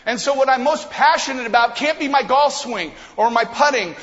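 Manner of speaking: loud, high, passionate build; fired-up preaching. Acted. Pastor rallying the church, loud and passionate. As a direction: preach with rising fire.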